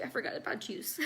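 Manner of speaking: fond whisper to herself; a tender remembered detail, whispered; whispered aside. Real, not acted. Fond aside, remembering her baby.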